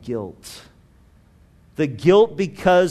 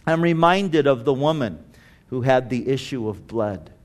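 ordinary talk, nothing marked

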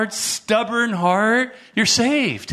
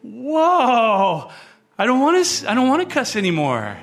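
higher-pitched, re-enacted amazement; amazed realization; 6 semitones higher than usual. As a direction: exclaim as if just discovering you've changed. Retells amazement at changed heart.

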